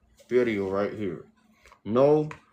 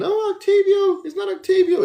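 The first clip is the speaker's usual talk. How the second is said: much higher, louder squeaky mock; mocking viewer voice; 9 dB louder than usual. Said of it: "so you guys won't be saying".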